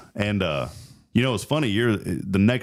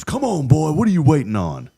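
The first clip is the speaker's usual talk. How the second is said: raised, high, goading voice; acted impatient dad; 9 semitones higher, 5 dB louder than usual. Dad goading his hesitant son, acted loud impatience. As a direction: imitate a father egging his boy on.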